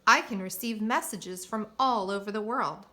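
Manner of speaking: proud storybook announcement with extra volume; Brooker. A narrator's reading. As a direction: boast about it, punching the key words. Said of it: "announced Brooker proudly".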